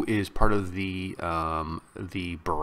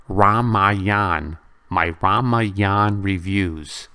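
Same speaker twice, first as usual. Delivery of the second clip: Ramayan said loud and slow, stretched out; drawn-out Ramayan; 9 dB louder, much slower than usual. Real, not acted. Host loudly plugs his Ramayan reviews, stretching the word. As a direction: lean on Ramayan, louder and slower. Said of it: "stressing the title".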